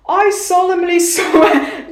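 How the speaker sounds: high, slow oath breaking into laughter; mock-solemn oath. Swearing an oath to the judge, mock-solemn and giggly. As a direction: declare it grandly, then crack up.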